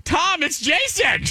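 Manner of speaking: high called-out name across a lot; calling out. Retold or imitated. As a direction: call out to be recognized.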